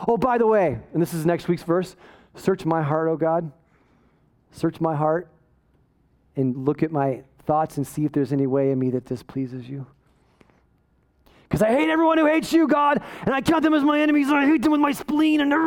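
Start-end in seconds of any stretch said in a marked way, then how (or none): 2.3-9.8 low, softened verse-prayer; low, gentle appeal to God; humble prayer. Acted. 11.5-15.6 high, hammed-up hateful tirade; over-the-top righteous fury; mock zealous rant. Hammed-up zeal against God's enemies.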